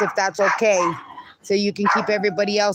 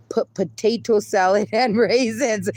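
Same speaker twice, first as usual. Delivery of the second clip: normal talking voice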